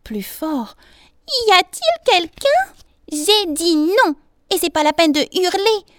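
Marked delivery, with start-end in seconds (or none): 1.5-2.6 very high, loud shouted question; crying out louder. A narrator's reading. Bee asks once more who is there, louder high cry. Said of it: "cria de nouveau, mais plus fort". 3.1-5.9 high, loud, annoyed retort; irritated little voice. A narrator's reading. Flea tells the bee not to yell, irritated squeak. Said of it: "fit la voix cette fois irritée".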